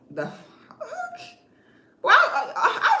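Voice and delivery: high squeal of a laugh, then sputtering; laughing disbelief. Real, not acted. Reacting with laughter.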